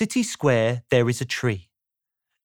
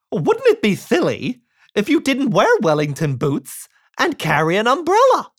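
louder, higher cheery silly voice; Mister Silly voice; 6 semitones higher, 4 dB louder than usual. Acted. Mister Silly quizzing the chicken, loud and cheery. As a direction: ask it brightly, rising to the umbrella. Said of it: "he said to the chicken".

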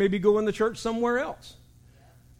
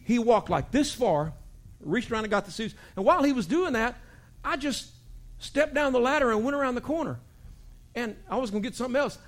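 ordinary talk

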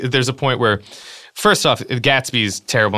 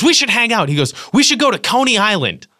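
eager Gatsby; 10 semitones higher than usual. Retold or imitated.

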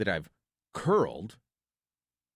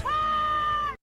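laughing; 3 dB louder than usual. Real, not acted. Punchline laugh.

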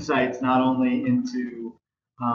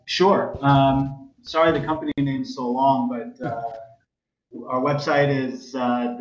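usual speaking voice

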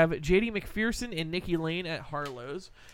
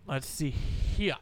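normal talking voice

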